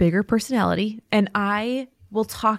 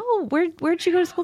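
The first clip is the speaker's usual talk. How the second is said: mock manager; 9 semitones higher than usual. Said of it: "like, said".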